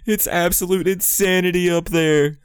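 high, loud excited fan voices; squealing crowd imitation; mock hype crowd. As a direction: gush like every fan at once. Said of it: "Everybody's like".

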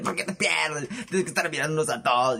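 normal talking voice

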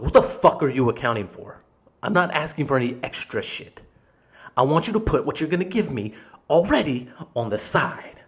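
loud, indignant retelling of an argument; angry retort. Retold or imitated. Customer challenging manager's excuse about the button, angry. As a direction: argue back, leaning hard on key words.

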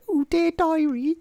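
high, slow mocking diary voice. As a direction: squeak it out like a teen's diary. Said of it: "I was making a".

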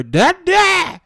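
put-on high, loud childish yell of Dad; imitating a yelling toddler. Toddler yelling for his dad right in his ear, high and loud. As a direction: shout it like a toddler demanding attention. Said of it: "yelling in my ear".

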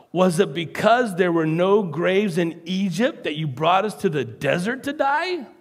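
mildly mocking complaint; sarcastic. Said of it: "this is really sarcastic".

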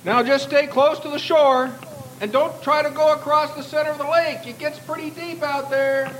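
high-pitched called warning from the dock; yelled warning. Acted. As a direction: call it out from the shore. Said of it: "he yelled".

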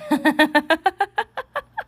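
high burst of laughter; long, high-pitched laugh. Real, not acted. Amused at friend's remark.

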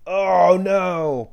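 loud, high hammed-up wail; mock dismay. Customer finds his soda missing, loud mock dismay. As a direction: cry out in exaggerated horror.

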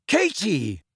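short reply. A narrator's reading. Teammate to Harry about the injured player, short reply. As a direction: answer in one curt word. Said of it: "she said shortly".

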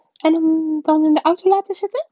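high, loud woman's voice; aunt suggesting. A narrator's reading. Aunt Petunia proposes leaving him in the car, pondering. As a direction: pitch it high as a tentative plan.

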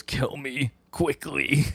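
slow, hammed-up dying request; mock dying plea. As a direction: beg slowly, as a choked man in mock agony. Choked enemy begs for death.

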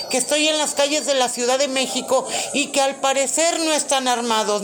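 loud, high, fed-up shouting; exasperated caller. Acted. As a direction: yell at a useless operator.

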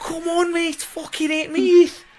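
high-pitched mock Scouse heckling; Scouse fans. Mimicking fans goading a wrestler, high Scouse accent. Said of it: "those Scouse fans from that Brian Danielson match".

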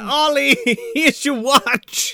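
high, slow, put-on voice with laughter; mock timid Stanny. Stanny hands Ollie his watch, mock sheepish.

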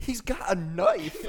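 high, loud, slow imitated warning; alarmed shout. Dylan warning a friend about the knife, alarmed shout.

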